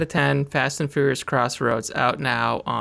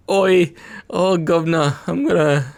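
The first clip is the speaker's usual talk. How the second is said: hammed-up Cockney accent, higher and louder; mock Cockney accent; 3 semitones higher, 5 dB louder than usual. Joking host doing an over-the-top Cockney accent for his cohost. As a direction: play a loud, higher Guy Ritchie-style Cockney. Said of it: "you've been watching too much Guy Ritchie".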